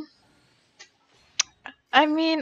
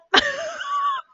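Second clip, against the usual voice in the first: high, whimpering laugh; nervous squealing laugh; 3 dB louder than usual. Real, not acted. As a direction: laugh out your nerves in a squeal. Nervous reaction.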